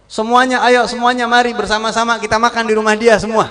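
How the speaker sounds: high, loud call to all; rally everyone to come eat; loud invitation. Acted. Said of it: "Setelah Nabi teriak". (ajakan keras kepada semua)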